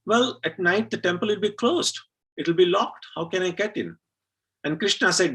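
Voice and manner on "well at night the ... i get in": put-on high, indignant little girl voice. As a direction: scold God like an outraged child. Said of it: "And then she said".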